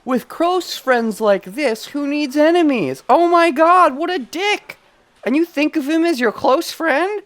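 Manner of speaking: high, loud, slow incredulous outcry; outraged disbelief. Real, not acted. Streamer yelling at the rude classmate, outraged. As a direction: shriek it in disbelief at the screen.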